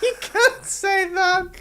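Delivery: high, giggling protest through laughter; laughing. Real, not acted. Laughing at a bold joke.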